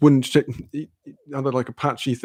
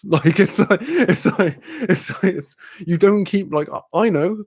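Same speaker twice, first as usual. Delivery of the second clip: laughing disbelief; 7 semitones higher than usual. Real, not acted.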